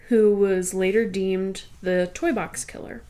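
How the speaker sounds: normal talking voice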